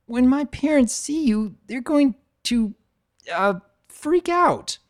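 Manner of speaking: high filly voice, slowing and hesitant at the end; nervous filly. Acted. New filly warning about her parents, high and hesitant. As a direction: drag out the last words like a worried little girl. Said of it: "Starbright stutters".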